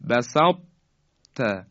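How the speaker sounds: heavy ط held shut, pause, then ت; correct incomplete merging. Shown as a demonstration. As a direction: press on the thick ط of بسط, release into ت. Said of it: "اون درشتی تا صفتش یه مقداری اثرش".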